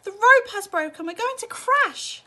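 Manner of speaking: high loud panicked cry, slowing at the end; shrill cry of terror; terrified cry. A narrator's reading. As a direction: scream it in panic. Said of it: "Missus T V cried out".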